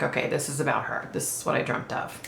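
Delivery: soft murmured realization; hushed recalled thought; hushed inner thought. Retold or imitated. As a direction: say it under your breath to yourself.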